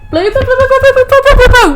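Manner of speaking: high, loud repeated calling of the cat's name. Real, not acted. Streamer calling her cat loudly.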